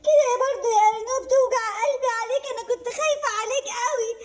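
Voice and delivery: a mother half sobbing with relief; tearful relieved mother. Acted. Mother relieved rabbit is back.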